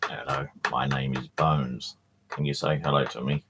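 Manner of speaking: a hint of a talking skull, low and unhurried; skull puppet voice. Voicing the skull Bones.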